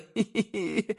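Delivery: soft high giggle; a quiet childlike laugh; childish giggle. A narrator's reading. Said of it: "залился самым детским смехом".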